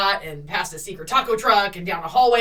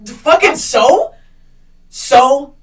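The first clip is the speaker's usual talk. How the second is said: slow, loud, scornful retort; retold scorn; 4 semitones higher, 10 dB louder, much slower than usual. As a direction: drag it out with contempt. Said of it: "And I'm like".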